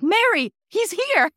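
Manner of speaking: high, loud shout to the usher; urgent calling out. Retold or imitated. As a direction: call out in excited urgency, retelling it.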